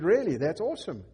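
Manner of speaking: high, loud Really that's awesome; light up with surprise; delighted surprise. Retold or imitated. Pastor reacting to the stranger, surprised and thrilled.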